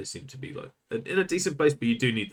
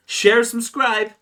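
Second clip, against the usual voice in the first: YouTuber outro voice; 12 semitones higher, 7 dB louder, much slower than usual. Acted. Mock YouTuber outro call.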